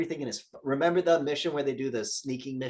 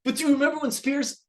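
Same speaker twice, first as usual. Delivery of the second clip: eager question; 13 semitones higher, 4 dB louder than usual. Retold or imitated. Imitating himself eagerly.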